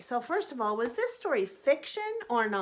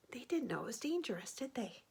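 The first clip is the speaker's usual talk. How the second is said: conspiratorial whisper; 9 dB quieter, faster than usual. Real, not acted. Whispered aside to class.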